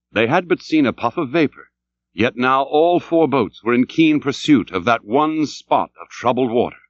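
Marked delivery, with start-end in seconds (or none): none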